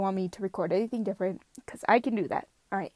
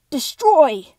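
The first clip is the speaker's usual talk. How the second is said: loud, higher villain yell; shrill final command; destroy take; 6 semitones higher, 13 dB louder than usual. Shown as a demonstration. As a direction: scream the order at full force. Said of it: "I'll give you a couple destroy takes".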